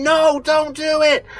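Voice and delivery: high, slow, eerie warning; psychic's warning voice. Retold or imitated. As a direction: draw it out like a spooky psychic. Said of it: "The psychic was there going".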